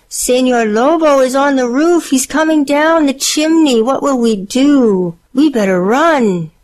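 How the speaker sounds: high-pitched storybook panic; alarmed cry. A narrator's reading. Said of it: "cried the first little tamale".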